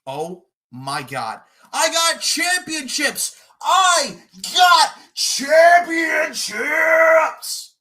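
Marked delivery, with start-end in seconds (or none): 1.7-3.3 high, slow mocking yell on "got"; mock shout. Mocking opponent's boast, yelled. 3.6-7.8 very slow, high, loud yell, "got" stretched long; drawn-out mock bellow. Mocking boast, drawn out.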